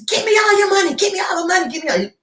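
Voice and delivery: high, pushy demand; mock yelling robber. Robber yelling at the teller, mock yell. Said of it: "yelling and screaming at her".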